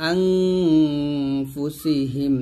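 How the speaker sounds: long hummed ng on "আং"; nasal ghunnah. Shown as a demonstration. Teacher showing learners ghunnah, "আং" drawn out nasally. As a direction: hold "আং" before releasing into "ফুসিহিম". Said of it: "আং গুণ করবেন".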